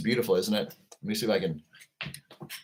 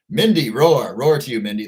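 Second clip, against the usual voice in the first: shouted roar greeting; 7 dB louder than usual. Real, not acted. Greeting viewers with roars.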